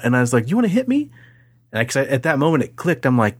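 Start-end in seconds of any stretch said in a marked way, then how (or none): 0.5-1.1 much higher taunting dare; mock dare. Facing the bully, a high taunting dare. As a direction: dare him, voice jumping up. Retold fight, his taunt back.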